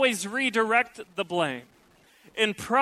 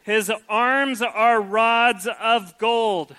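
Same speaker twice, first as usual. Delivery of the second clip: very slow, louder, lingering admiration; savoring praise; 2 semitones higher, 8 dB louder, much slower than usual. Pretend. Preacher reading the bride's praise to his wife, relishing. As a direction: relish every word. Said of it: "Kat, take notes".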